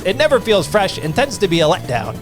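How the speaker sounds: ordinary talk, nothing marked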